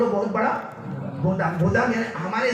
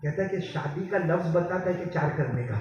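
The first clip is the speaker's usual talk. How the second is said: usual speaking voice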